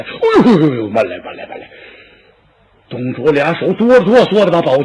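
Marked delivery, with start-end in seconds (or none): 0.0-1.7 put-on mild alarmed cries of an old man stopping a suicide; a touch of panic, holding her back; frightened old lord. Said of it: "可把董卓吓坏".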